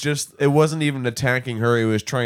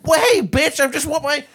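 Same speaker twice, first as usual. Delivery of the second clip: squeaky hawk voice; 3 dB louder than usual. Retold or imitated. Hawk asks the lady for its dinner, high cartoon voice. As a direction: play the hawk as a silly high-pitched bird. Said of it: "just flies down like".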